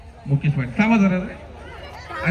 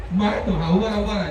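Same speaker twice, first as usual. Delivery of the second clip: ordinary talk, nothing marked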